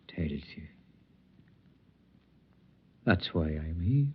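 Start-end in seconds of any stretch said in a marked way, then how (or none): 0.1-0.7 whisper. Acted. Wanderer whispering his advice to the girl. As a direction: drop to a whisper on the last words. Man urges her gently.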